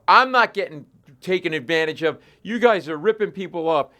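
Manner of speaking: rising fury that spills out; retold outraged yell. Man yelling at vet staff about cost, building outraged shout. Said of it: "then I really snap".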